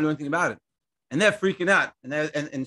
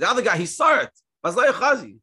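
emphatic; 6 semitones higher, 5 dB louder than usual. Acted. Preacher stressing the point.

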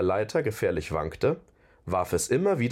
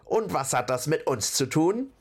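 high-pitched troll leader's retort; calling troll chief; 6 semitones higher than usual. A narrator's reading. Troll leader answers the visitors, calling out. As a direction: call it out as a challenge. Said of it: "rief der Anführer der Nachttrolle".

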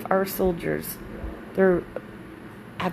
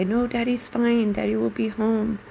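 put-on reassuring child voice; 7 semitones higher than usual. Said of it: "so I would lie and say".

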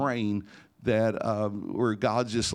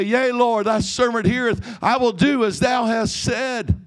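loud, high, hammed-up holy reply; mocking the reply he should have prayed, high and grand; mock pious; 14 semitones higher, 8 dB louder than usual. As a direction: ham up an old-fashioned pious prayer.

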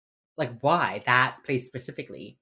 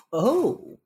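knowing oh; 9 semitones higher than usual. Retold or imitated. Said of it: "His mom would be like".

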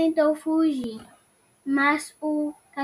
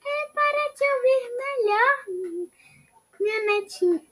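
squeaky grandma voice; 8 semitones higher than usual. Acted. Wolf answers as grandma.

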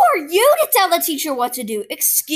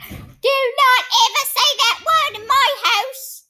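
grandma scolding; 10 semitones higher than usual. Retold or imitated. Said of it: "she's like".

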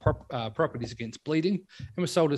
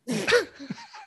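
high laugh. Real, not acted. Laughing at the pun.

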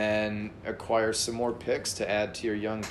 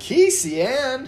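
incredulous; 13 semitones higher, 8 dB louder than usual. Real, not acted. Mocking a player's name.

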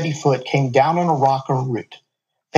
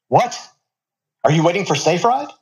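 higher, quick eager question; a nervous kid checking again; young guy asking; 4 semitones higher than usual. A narrator's reading. Driver repeats his question.

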